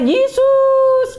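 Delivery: teriakan panik tinggi dan keras; teriak Yesus. Retold or imitated. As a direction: jerit nama Yesus seperti hampir menabrak. Teriak Yesus saat tabrakan. (teriakan panik memanggil Yesus)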